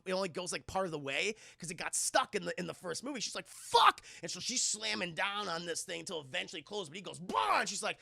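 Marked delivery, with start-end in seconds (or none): 3.5-4.0 high, loud burst of frustration; frustrated mock shout. Character frustrated by stuck gate. 7.2-7.6 high, loud imitated roar; sudden loud "bah" as a killer lunging; mock monster roar. Killer's noise at closing gate.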